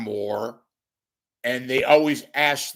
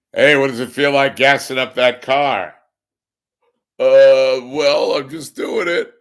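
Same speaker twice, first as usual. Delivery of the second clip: mock reporter interview; 4 semitones higher, 13 dB louder than usual. Mock reporter and driver exchange.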